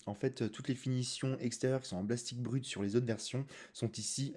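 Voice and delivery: soft ASMR voice right in your ear; ASMR whisper. Real, not acted. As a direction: hush it close to the mic, ASMR. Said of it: "A S M R".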